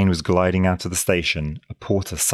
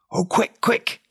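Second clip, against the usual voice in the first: high, urgent frightened cry; alarmed; 13 semitones higher, slower than usual. A narrator's reading. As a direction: gasp it out in alarm. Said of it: "exclaimed the girl in alarm".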